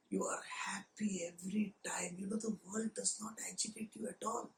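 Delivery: soft, breathy guru hush; mock a guru's dreamy whisper; hushed reverent. Gurus describing bliss in a dreamy whisper.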